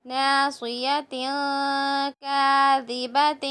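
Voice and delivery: slow, drawn-out tajweed reading; slow recitation. Shown as a demonstration. Teacher slowly reciting the words for the pupils. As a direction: lay each syllable down slowly for the class. Recites phrase while building it.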